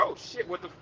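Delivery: whispered shocked curse. Retold or imitated. American abroad hit by culture shock, whispered curse. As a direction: breathe it out in shock.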